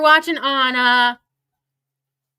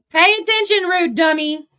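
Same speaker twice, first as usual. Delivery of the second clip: loud, higher jeer; taunting yell; 6 semitones higher, 7 dB louder than usual. Real, not acted. Streamer taunting a rival creator, loud and mocking. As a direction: bark the order at the rival, louder.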